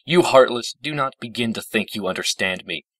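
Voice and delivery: loud, high start, cutting in; angry interruption. A narrator's reading. Celestia interrupting Discord's accusation, indignant. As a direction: cut him off indignantly. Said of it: "interrupted him".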